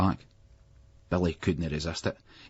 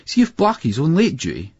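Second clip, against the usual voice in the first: higher, louder, anxious; worried call; 8 semitones higher, 6 dB louder than usual. A narrator's reading. Schoolboy fretting about the strict teacher, raised anxious voice. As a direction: fret out loud about the teacher. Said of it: "biting his bottom lip".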